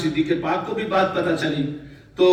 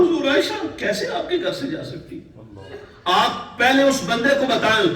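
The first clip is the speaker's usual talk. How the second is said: higher, fervent protest; fiery indignation; 6 semitones higher than usual. Retold or imitated. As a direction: voice Umar's zeal on a raised pitch. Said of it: "جلال کی کیفیت".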